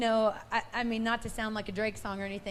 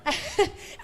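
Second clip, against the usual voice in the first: burst of laughter; 12 semitones higher, 6 dB louder than usual. Real, not acted. Bright laugh after rushing.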